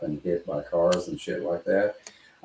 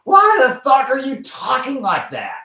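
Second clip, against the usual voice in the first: much higher and louder, a mock exasperated yell; imitating angry partner; 11 dB louder than usual. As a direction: mimic the partner yelling at the drunk speaker in disbelief. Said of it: "he goes".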